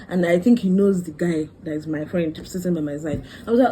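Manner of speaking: ordinary talk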